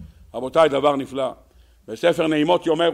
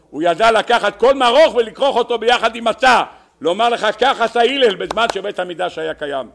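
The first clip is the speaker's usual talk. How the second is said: raised, higher, driving sermon voice; impassioned preaching; 7 semitones higher, 7 dB louder than usual. Real, not acted. Rabbi linking bitter herb and matzah for listeners, impassioned preaching. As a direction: press the point home loudly.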